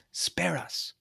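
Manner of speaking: begging in a high whisper; frightened whisper. Acted. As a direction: whisper a desperate plea. Raven begs for mercy.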